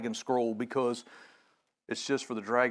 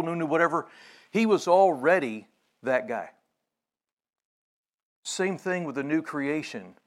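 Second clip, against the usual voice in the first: normal talking voice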